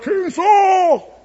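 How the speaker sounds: a high call across the canyon. Retold or imitated. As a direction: call out to the king far away. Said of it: "he yells out".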